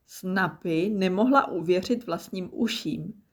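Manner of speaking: usual speaking voice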